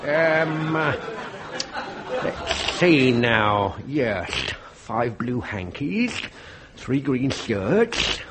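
low, slow, gloating villain; sinister slow reading. Acted. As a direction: savour each item of the list. Villain reads laundry list.

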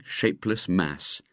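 ordinary delivery, nothing marked

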